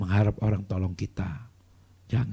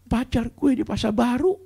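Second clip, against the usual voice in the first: high, slow imitated wail; mock crying; 12 semitones higher, 4 dB louder than usual. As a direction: wail it like someone in tears. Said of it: "nangis depan saya". (menirukan tangisan, nada tinggi)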